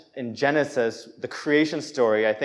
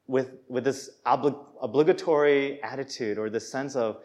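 normal talking voice

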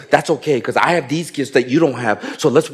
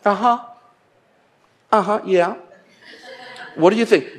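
dismissive uh-huh; 4 semitones higher, 3 dB quieter than usual. Retold or imitated. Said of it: "you'll be like".

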